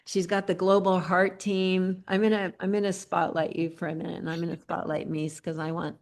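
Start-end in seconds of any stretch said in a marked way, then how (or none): none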